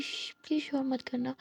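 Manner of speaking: hushed whispered warning; hushing a friend by a sleeping girl. Acted. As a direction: shush her quietly. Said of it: "इशारा करती है".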